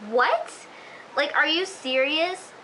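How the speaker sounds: stunned what, then a slow are you serious; incredulous. Retold or imitated. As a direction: react like you can't believe what you just heard. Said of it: "I'm just like".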